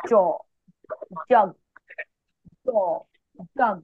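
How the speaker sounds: "জ" and "জগ" with a hard, pressed j; hard, pressured j. Shown as a demonstration. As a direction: put pressure on the j of "জগ". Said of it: "অনেক pressure দিয়ে, অনেক শক্তভাবে". ("জ", "জগ" অনেক শক্তভাবে, pressure দিয়ে)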